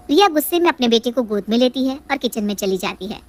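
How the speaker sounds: ordinary talk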